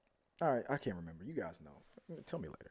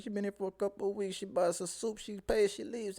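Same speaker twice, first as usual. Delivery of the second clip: high character voice; 8 semitones higher than usual. Acted. Acted quote of townsperson.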